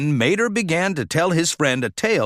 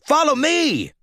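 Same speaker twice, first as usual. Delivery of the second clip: shouted; 12 semitones higher than usual. A narrator's reading. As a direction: call them to follow. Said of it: "Mater shouted".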